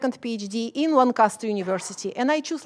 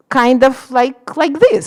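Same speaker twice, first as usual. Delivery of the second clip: loud, slow and forceful; Slavic speaking style; 14 dB louder than usual. Shown as a demonstration. Showing how Slavs are supposed to speak, loud. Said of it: "We are supposed to speak".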